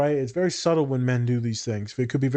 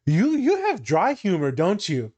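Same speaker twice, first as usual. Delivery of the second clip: student asking; 6 semitones higher than usual. Retold or imitated. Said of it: "they're like".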